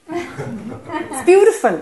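It's beautiful, drawn out slowly through a laugh; laughing delight. Real, not acted. Teacher marvelling at the paradox to students, laughing. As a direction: laugh with real delight at how it works.

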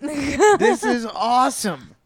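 laughing delight. Real, not acted.

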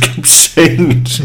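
laughing. Real, not acted. Laughing at repeated line.

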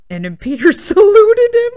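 laughing through the words; high, loud laughing. Real, not acted. Laughing at son's salute.

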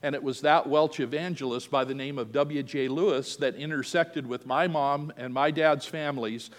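usual speaking voice

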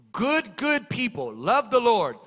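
ordinary talk

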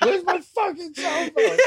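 very high, drawn-out imitated holler; slow high yell, half laughing; mock frantic shout. Acted movie quote, frantic demand.

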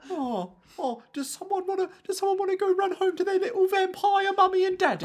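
squeaky mocking high voice; mocking baby talk. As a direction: taunt them like a whiny kid.

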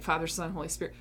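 ordinary talk, nothing marked

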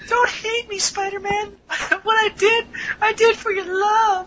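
high, hammed-up falsetto femme fatale. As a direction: squeal it high, breaking into a sob at the end.

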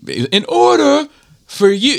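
slow, high imitation of a yelling instructor; drill instructor's accent. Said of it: "I'm trying to do his accent".

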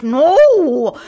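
anxious little man's outburst, high and loud; a drawn-out mock cry bursting up from inside; Max Wright. Host imitating the sitcom dad's feeling bubbling up, anxious loud outburst. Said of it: "bubbles up from within inside him".

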